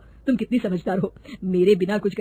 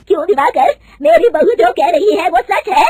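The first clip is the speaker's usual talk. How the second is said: much higher, louder furious question; angry demand; 8 semitones higher, 11 dB louder than usual. Acted. Mother-in-law confronts cheating manager, furious and loud. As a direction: confront him in rage. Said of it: "गुस्से से दिवाकर को पूछती है".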